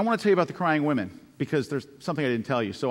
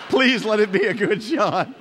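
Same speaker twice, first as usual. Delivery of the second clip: laughing plea; 7 semitones higher, 7 dB louder, slower than usual. Real, not acted. Desperate plea to self-timer camera.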